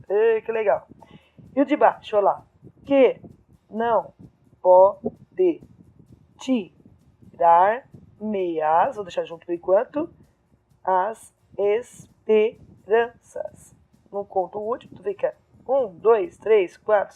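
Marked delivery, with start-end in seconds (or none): 2.9-9.0 slow verse in isolated syllables; syllable-by-syllable scansion. Shown as a demonstration. Teacher scanning the third verse, slow syllable by syllable. 10.8-13.8 very slow, spaced-out syllables; syllable-by-syllable scansion. Shown as a demonstration. Teacher scanning the end of a verse, slow separated syllables.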